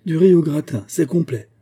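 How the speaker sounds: indignant exclamation; exclaiming. A narrator's reading. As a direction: cry it out, pitched well up. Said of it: "Hutin s'écria".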